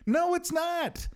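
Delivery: high, drawn-out protest; aghast high-pitched no; horrified objection. Acted. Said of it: "And I was horrified".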